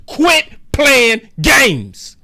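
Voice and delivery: slow, emphatic angry demand. Real, not acted. Vlogger telling team to quit games, slow angry demand. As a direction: spit out each word slowly.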